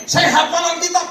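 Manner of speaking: high-pitched mocking brag; mock boasting. Preacher mocks hearers who only memorise Scripture, high boast.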